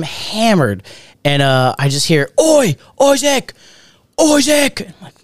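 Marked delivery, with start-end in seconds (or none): 2.4-4.8 put-on high, drawn-out Manchester-accented call. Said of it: "he grew up in Manchester".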